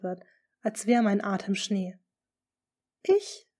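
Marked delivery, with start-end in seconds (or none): 3.0-3.5 high, stunned single word; stammering disbelief. A narrator's reading. Accused lady to the duke, stammering in disbelief. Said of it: "stammel ich ungläubig".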